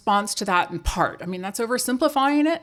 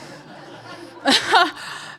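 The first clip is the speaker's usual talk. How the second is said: laughing; 8 semitones higher than usual. Real, not acted. Laughs at own aside.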